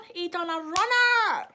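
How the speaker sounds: high, drawn-out loud holler; exclaimed shout. Real, not acted. Vlogger telling how Ashton's pal fled the bill, gleefully shouted. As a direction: shout it slow and high, milking it.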